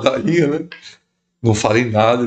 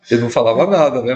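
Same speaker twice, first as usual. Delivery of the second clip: ordinary delivery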